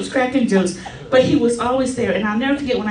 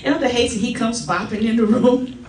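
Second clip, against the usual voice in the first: ordinary talk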